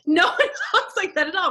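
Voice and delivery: ordinary talk, nothing marked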